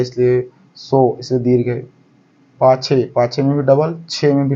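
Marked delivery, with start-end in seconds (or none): 0.8-1.2 सो held slowly and long; stretched सो. Shown as a demonstration. Teacher demonstrating the long सो to students. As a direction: draw सो out slowly. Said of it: "उच्चारण ज़्यादा लग रहा है".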